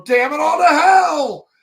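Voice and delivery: high, loud, slow frustrated yell; furious outburst. Retold or imitated. Edward raging over his research notes, loud high yell. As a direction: blow up at the notes. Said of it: "Edward Elric go".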